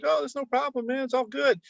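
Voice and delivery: imitated no-worries reply, pitched high; imitating easygoing reassurance. Retold easy reply of comic artist.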